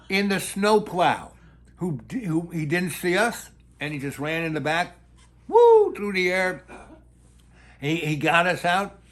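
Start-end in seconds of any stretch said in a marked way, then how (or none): 5.5-5.9 loud, drawn-out high "woo"; whooping flight noise. Retold or imitated. Storyteller showing the car flung through the air, loud whoop. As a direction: whoop like something launched skyward.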